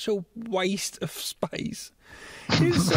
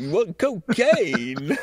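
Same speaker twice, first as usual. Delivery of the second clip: louder, very slow hammed-up question; drawn-out mock astonishment; 5 dB louder, much slower than usual. Goblin King asked about coke, hammed-up drawl. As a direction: stretch the word out theatrically.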